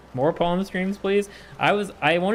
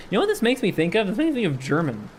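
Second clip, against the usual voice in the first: ordinary talk, nothing marked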